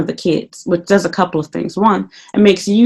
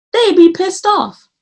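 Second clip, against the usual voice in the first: exaggerated outrage; 11 semitones higher, slower than usual. Exaggerated coworker reaction.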